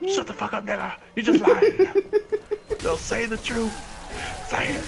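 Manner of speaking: high, hammed-up bullying; angry demand. Rival demanding the truth from a dying friend, angry insistence.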